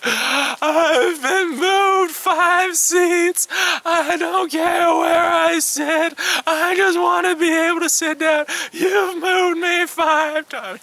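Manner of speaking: put-on very high, loud, slow wailing old lady; hammed-up sobbing complaint; mock bawling. Old lady on the plane bawls at the stewardesses, high loud mock wail. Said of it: "starts just bawling".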